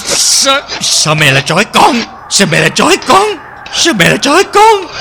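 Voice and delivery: high, bewildered begging; frightened pleading. Acted. Terrified daughter begging mother to explain why she is tied up. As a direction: plead with mother, not understanding.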